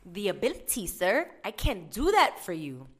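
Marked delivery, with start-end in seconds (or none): none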